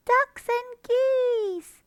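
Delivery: very high, slow loud cry; hungry cubs licking their lips; excited fox cubs. A narrator's reading. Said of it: "cried the small foxes".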